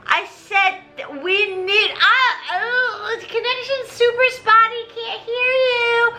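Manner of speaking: lightly put-on French accent; a high comic Lafayette in French accent. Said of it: "But do it in a French accent".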